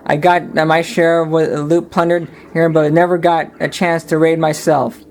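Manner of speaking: first-person storyteller voice over music; story narration. A narrator's reading. Smee narrating his past to the listener, storytelling. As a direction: tell it like an old sailor's tale.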